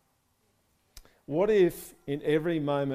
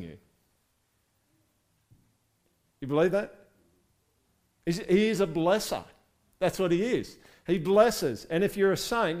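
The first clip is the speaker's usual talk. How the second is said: louder, rising fervent preaching; preaching at full force; 4 semitones higher, 5 dB louder than usual. Real, not acted. Pastor rallies his congregation, full fervent preaching. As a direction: lift the room, preach it out.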